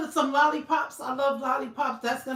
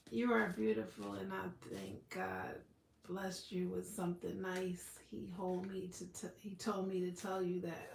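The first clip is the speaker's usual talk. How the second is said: spirit guide voice; 6 semitones lower, 14 dB quieter than usual. Acted. A spirit guide giving a viewer a message, low and hushed. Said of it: "spirit person or guide".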